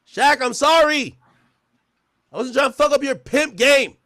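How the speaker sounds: loud, high-pitched drawn-out hollering; hammed-up desperate yelling, stretched words; mock pleading shout. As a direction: call out to Shaq as if begging from far off. Calling out mock apology to Shaq.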